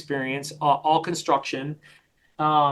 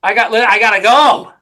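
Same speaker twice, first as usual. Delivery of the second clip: annoyed client thought; 3 semitones higher, 6 dB louder than usual. Retold or imitated. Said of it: "it's a subconscious thought".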